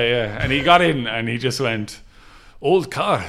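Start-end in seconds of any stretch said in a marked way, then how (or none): 2.6-3.2 a lightly put-on Swedish accent, a touch higher; hint of a Swedish accent. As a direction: clip it short like a Swedish visitor. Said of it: "disservice to his accent".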